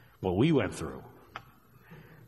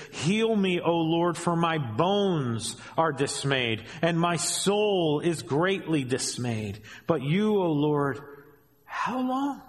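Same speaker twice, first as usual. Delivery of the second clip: higher, pleading and slowed on the middle lines; fervent scripture reading; 6 semitones higher, slower than usual. Acted. Preacher reading David's lament to the church, pleading. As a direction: cry out to the Lord for help.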